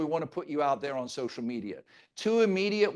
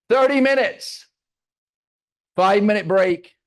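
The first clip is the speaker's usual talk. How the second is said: emphatic; 4 semitones higher, 9 dB louder than usual. Real, not acted.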